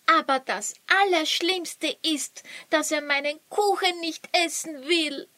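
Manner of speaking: hoch und jammernd, etwas schneller. Acted. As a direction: klagt ihr Leid. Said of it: "jammerte Luri". (Luri jammert dem Zaubermeister vor)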